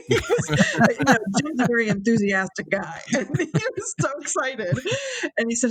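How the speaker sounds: laughing through the words; chuckling as she remembers. Real, not acted. Recalling Jim meeting Jack, laughing. Laughing retelling Jim's excitement.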